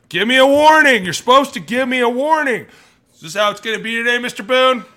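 high, loud shouted complaint; acted angry protest. Player arguing with the referee over a missing warning, imitated angry shouting. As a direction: yell in mock outrage at the official. Acting out a player's sideline argument with ref.

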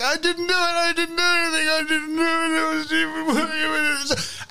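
high-pitched hammed-up blubbering denial; whimpering, crying. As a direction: wail it like a terrified kid collapsing. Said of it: "whimpering, crying Greyguard".

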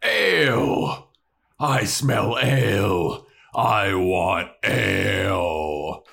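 slow, bellowing, greedy troll. Retold or imitated. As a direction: bellow for ale like a brute. Troll voiced craving ale.

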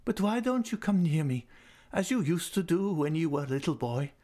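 put-on high, tender old lady's voice; fond old lady. A narrator's reading. Old lady invites Raoul near, voice high and fond. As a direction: coax him close like a loving old aunt.

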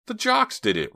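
high, sudden blurt. A narrator's reading. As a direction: let it burst out high. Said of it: "Ricky blurted out".

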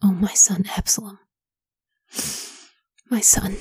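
a whispered grieving cry, trailing off; grieving lament. Acted. King David mourning his dead son, in a grieving whisper. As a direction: let the king's grief fade to a broken whisper. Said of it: "burst into tears".

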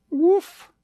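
high little bark; puppy woof. Acted. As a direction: yip like a tiny puppy.

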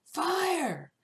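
high, loud panicked yell of fire; a panicked moviegoer yelling fire at the crowd. Retold or imitated. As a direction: scream it to the whole theater. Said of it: "somebody else yells".

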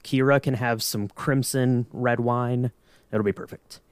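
normal talking voice

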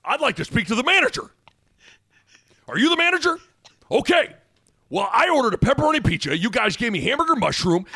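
fast, demanding customer complaint; rising into a shouted fed-up; entitled customer impression.

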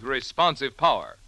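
normal talking voice

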